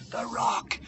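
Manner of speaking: hushed inner voice, recalling; thinking aloud. Acted. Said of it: "he's thinking".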